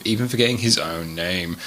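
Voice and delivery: ordinary talk, nothing marked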